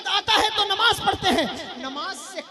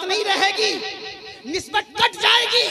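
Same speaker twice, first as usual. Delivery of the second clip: stern warning; 3 semitones higher, 6 dB louder than usual. Real, not acted. Warning to congregation.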